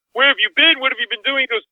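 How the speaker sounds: high, loud mock fury of the senior partner; angry boss. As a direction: grill him like a furious boss at midnight.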